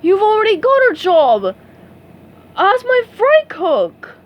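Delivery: furious crab blowing up; bellowing boss. A narrator's reading. Mister Krabs telling SpongeBob off, outraged bellow.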